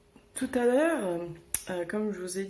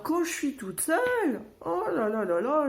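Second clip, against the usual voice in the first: exasperated; 8 semitones higher than usual. Real, not acted. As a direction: stress the outrage. Emphatic exasperation at being alone. (exaspérée)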